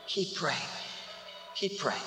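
low, very soft urging; hushed exhortation. Real, not acted. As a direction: drop right down and encourage tenderly. Gentle closing exhortation.

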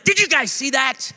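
high-pitched, thrilled exclaiming; so excited. Retold or imitated. Man returning to his family after serving, excited. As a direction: gush to the family, bursting with excitement. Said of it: "I'm so excited".

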